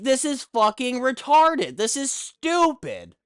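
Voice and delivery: ordinary talk, nothing marked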